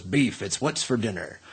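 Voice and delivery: low, soft ad tagline; Robert Mitchum. Retold or imitated. Film star reading the beef ads, low and soft. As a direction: imitate an actor's low-key beef advert. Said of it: "where Robert Mitchum".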